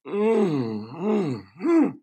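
imitating wife's hummed moan over food; mock moan of pleasure. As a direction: hum it like a bite that tastes amazing. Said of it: "like, moaning with pleasure".

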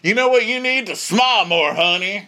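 loud, high, hammed-up condescending voice; mocked pushy guy. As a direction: lecture her as a patronizing caricature. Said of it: "I'm such a guy".

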